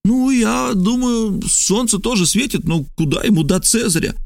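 high, insinuating character voice; probing Trasul. Acted. Trasul tests the stranger on Caesar's columns, high probing voice. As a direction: pitch it high to draw the other man out.

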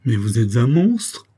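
appalled cry, swooping up at the end; horrified exclamation. A narrator's reading. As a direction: exclaim in horror at the confession. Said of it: "s'écria Boisselin".

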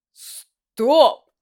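sharp, loud cry of stop; loud exclamation. Acted. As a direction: snap out of it with a shout. Stunned, repeating to himself.

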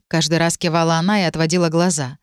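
plain reading voice

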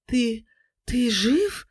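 high, halting breathy question; breathy, shaken. A narrator's reading. Wife finding her husband alive, breathy shock. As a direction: gasp it out, deeply shocked. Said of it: "с придыханиями произнесла Марина после паузы, явно потрясённая".

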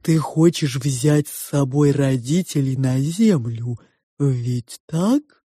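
slow, drawn-out character voice; probing two-faced Sofya. A narrator's reading. Sofya questions the girl about her parents, probing. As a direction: probe slowly, stretching the words.

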